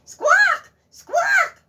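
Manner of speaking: high shrieking squawks; goose squawk. Acted. Goose squawking to wake the farmer, shrieking.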